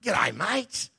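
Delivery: a casual Aussie g'day, lightly put on; mock Australian mate greeting. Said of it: "shock horror".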